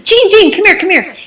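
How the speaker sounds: high, loud imitated call; imitating an eager husband calling his wife. Retold husband calling wife over.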